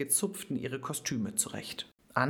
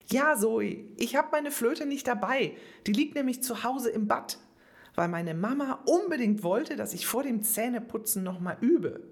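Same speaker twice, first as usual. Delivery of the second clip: put-on high, louder, indignant girl; angry; 8 semitones higher, 3 dB louder than usual. A narrator's reading. Angry girl complaining she forgot her flute. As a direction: complain hotly to Zoe. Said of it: "eine ziemlich wütende Antonia".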